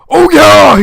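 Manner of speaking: a high, loud triumphant yell; shout. Acted. Joe yelling in triumph over his beaten son. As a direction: cheer like you just won the game. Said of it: "he shouted".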